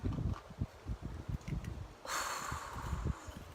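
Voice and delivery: long, soft exhale; heavy sigh. Real, not acted. Mother after a hard confession, long heavy sigh. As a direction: let out a long, weighted breath.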